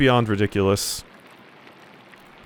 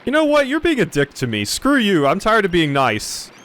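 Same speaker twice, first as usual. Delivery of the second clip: angry outburst; 10 semitones higher than usual. Acted. Monk loses temper at Farink.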